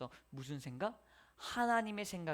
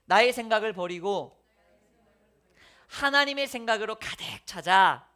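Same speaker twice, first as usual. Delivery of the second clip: call to repeat; 10 dB louder than usual. Shown as a demonstration. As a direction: proclaim it for the congregation to echo. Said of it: "따라해 봅시다".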